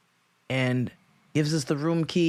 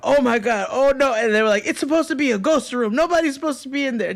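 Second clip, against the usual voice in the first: high, fast, louder panic; frantic clerks, pitched way up; panicked staff; 10 semitones higher, 3 dB louder, faster than usual. Retold or imitated. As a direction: gabble like panicking hotel staff. Staff freaking out voiced.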